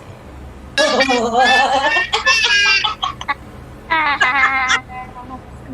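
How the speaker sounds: long cackling witch laugh. Pretend. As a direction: cackle like a wicked old witch. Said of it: "give me your best witch laugh".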